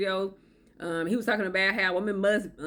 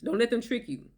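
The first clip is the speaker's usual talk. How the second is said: ordinary delivery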